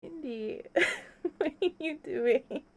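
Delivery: usual speaking voice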